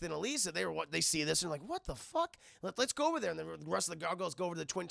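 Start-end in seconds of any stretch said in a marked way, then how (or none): 1.6-3.3 high startled yelp; surprised. Retold or imitated. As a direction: blurt it out in shock. Gargoyles reacting in retold story.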